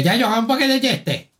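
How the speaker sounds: high mock Canarian accent; high, exaggerated Canarian accent; Canarian customer. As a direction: imitate a hard-to-follow Canarian customer. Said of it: "y entra un fulano y dice".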